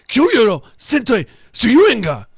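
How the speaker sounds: Japanese pronunciation. Acted. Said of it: "You do that so well".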